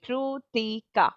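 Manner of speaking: KRITIKA broken slowly into three parts, Kri-ti-ka; syllable by syllable. Shown as a demonstration. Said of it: "There are three parts in my".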